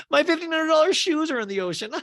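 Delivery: very high, loud wail; hammed-up squeal of dismay; Angie K. Angie K mourns pricey shoes, imitated high wail. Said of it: "Angie K goes".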